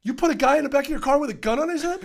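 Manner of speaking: loud, high, fast mock outrage; retold outraged scolding. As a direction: imitate a sergeant appalled at a rookie. Sergeant berates cop over gun.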